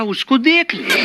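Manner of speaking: mock-angry mother snapping, high and drawn out; a hammed-up furious mom shutting the kid down. Mother in a temper answering her son's cartoon request, mock angry. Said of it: "माँ गुस्से में थी".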